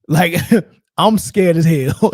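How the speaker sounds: half-laughing at his own fear; chuckling retelling of a scare. Real, not acted.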